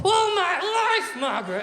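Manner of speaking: high, drawn-out mock yell; hammed-up husband at breaking point, loud and very high; exasperated outburst. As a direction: stretch it into a strained high shout. Husband's acted outburst at wife.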